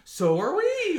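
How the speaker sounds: very loud, high mimicked cheer; thrilled exclamation. Fellow alumni meeting mom in London, thrilled. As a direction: shout it high in delighted recognition.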